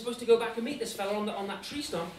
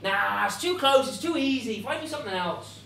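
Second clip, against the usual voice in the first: acted dismissiveness; 8 dB louder than usual. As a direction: wave it away with exaggerated scorn. Hans dismisses the target, stalling.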